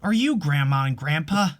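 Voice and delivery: usual speaking voice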